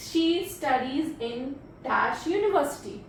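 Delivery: very slow, word by word; slow dictation. Shown as a demonstration. Teacher reading a sentence slowly to students. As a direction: read it out slowly for the class. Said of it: "sound क्या निकल रही है".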